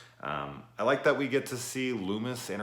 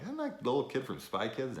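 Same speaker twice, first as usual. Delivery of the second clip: surprised recognition; 7 semitones higher than usual. Retold or imitated. Recognising the actor.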